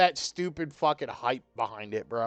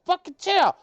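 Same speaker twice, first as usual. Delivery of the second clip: annoyed yell; 6 semitones higher, 5 dB louder than usual. Real, not acted. Yelling at cat on his lap.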